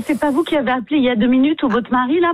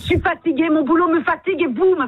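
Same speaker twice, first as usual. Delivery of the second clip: worn-out, higher-pitched complaint; real exasperation; 5 semitones higher than usual. Real, not acted. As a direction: plead at the end of your tether. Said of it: "Pourquoi vous êtes énervée". (excédée, au bout du rouleau)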